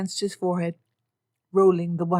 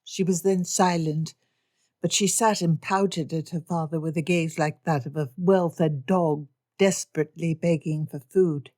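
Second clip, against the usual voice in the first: plain reading voice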